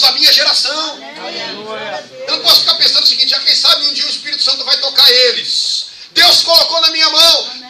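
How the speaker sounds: loud, high preaching; preaching at full force. Acted. Pastor preaching to the congregation at full force, loud and high. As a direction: preach it out to the church.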